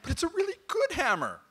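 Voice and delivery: a god whining to the other gods; whiny plea. Acted. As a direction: beg like a sulking child. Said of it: "He's like".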